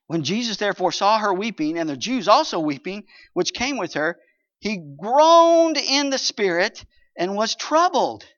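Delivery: loud, ringing Bible reading, slowing at the end; scripture reading. Acted. Pastor reading John to the congregation, proclaiming. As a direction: proclaim the verse to the church.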